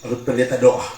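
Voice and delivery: usual speaking voice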